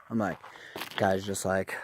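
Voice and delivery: normal talking voice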